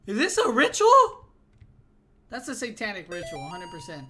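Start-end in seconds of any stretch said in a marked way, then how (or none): 0.1-1.3 much higher, alarmed; high startled question. Real, not acted. Gamer seeing a ritual in the house, alarmed. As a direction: squeak it out in alarm. Spooked by scene.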